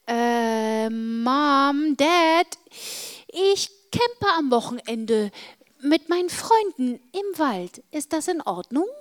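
drawn-out, rising hesitant request. Acted. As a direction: tentatively ask parents for permission, voice climbing. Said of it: "fragte ich zögernd".

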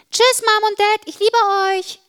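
high, loud, joyful goodbye; cheerful call. Acted. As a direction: call out happily to your parents, pitch way up. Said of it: "rief ich fröhlich".

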